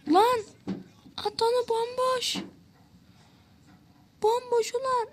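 real frantic cry at a wide-open net; frantic high urging. Real, not acted. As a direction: beg the player to shoot at the empty goal. Open goal chance during the match.

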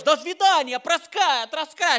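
high, mock Russian gibberish. Comedian mocks angry Russian invaders, high mock Russian. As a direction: parody an angry Russian soldier. Nervous Russian soldiers mocked.

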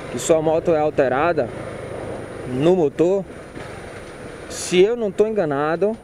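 ordinary talk

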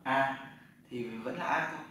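ordinary talk, nothing marked

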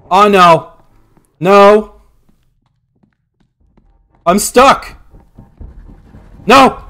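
loud panicked screaming; being chased. Real, not acted. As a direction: scream when caught. Caught by monster.

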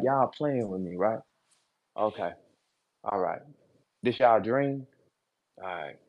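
low, quiet and menacing; low menacing demon voice. Retold or imitated. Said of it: "when I talk like this".

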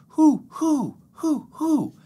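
high hooting owl call; playful high hoo hoo. Acted. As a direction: hoot like an owl in the night. Imitating an owl for kids.

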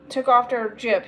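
usual speaking voice

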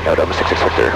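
ordinary talk

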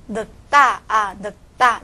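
늙다 said as 늑따, ㄹ silent; tensed consonant. Shown as a demonstration. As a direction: stop on k, then a tense 다 in 늙다. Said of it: "要把这个다变成重音". (늙다读右边ㄱ，다读重音)

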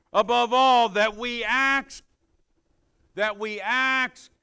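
loud, high scripture proclamation, words stretched long; full-force preaching. Real, not acted. Preacher having the congregation repeat the verse, fervent and loud. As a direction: belt out "all" and draw it out.

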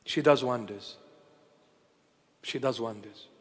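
low, soft, reverent murmur; hushed awe. Real, not acted. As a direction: let the praise fade almost away. Awed praise after a long pause.

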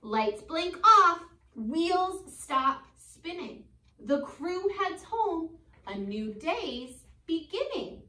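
slow, lilting storybook read; gentle read-aloud for kids; reading to children. Acted. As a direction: read it like a bedtime picture book. Teacher reads picture book aloud.

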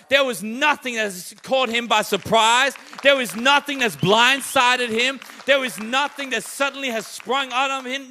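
loud, driving preacher's build; full-force preaching. Acted. Preacher declaring God foresaw the storm, loud and driving. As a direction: hammer each line home to the congregation.

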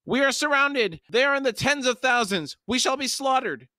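panicked, very high shout; Pormqual. A narrator's reading. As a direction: shout in shrill alarm. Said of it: "Pormqual shouted".

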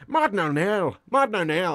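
Irish-accented name on repeat; Martin O'Neill. Shown as a demonstration. Impressionist showing how to do a manager, Irish Martin O'Neill. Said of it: "over and over in Irish".

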